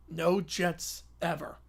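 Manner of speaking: slow, soft and solemn, No leaned on; a quiet solemn vow. Acted. Kids swearing off the Jets, slow solemn vow. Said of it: "They all nod solemnly".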